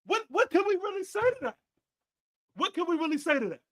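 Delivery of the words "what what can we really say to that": higher, talking through real laughter; laughing. Real, not acted. Laughing as he admits the Cowboys fan is right. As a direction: concede the point while cracking up. Laughing while conceding to Danny.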